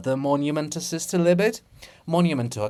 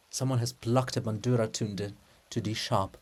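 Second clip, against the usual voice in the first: ordinary delivery, nothing marked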